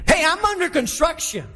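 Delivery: high, emphatic declaration with a falling end; like you mean it. Shown as a demonstration. Preacher modelling the line for the congregation, emphatic. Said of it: "say it like you mean it".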